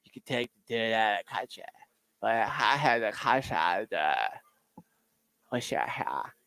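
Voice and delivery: soft, murmured mock of the ad line; mocking her voice.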